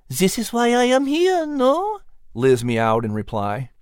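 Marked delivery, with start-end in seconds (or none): 0.1-2.0 high, purring cat voice with a French touch; a wise cat speaking fondly of her mon ami; high feline voice, knowing and affectionate; Liz the cat. Acted.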